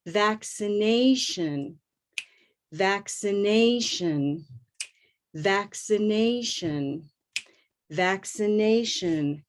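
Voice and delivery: slow "Vaccination" with the A gliding; moving A in vaccination. Shown as a demonstration. Teacher modelling "Vaccination" slowly for repeating. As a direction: stretch the A in "Vaccination". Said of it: "New word".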